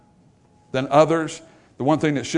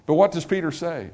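usual speaking voice

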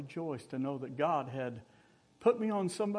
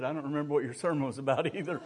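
laughing; 4 dB quieter, faster than usual. Retold or imitated. Old man's joke, laughing.

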